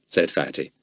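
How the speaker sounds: plain reading voice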